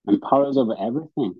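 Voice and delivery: usual speaking voice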